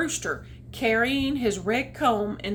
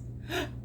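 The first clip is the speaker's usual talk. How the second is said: pre-sneeze gasp; 6 semitones higher, 10 dB quieter than usual. Acted. Rag man about to sneeze.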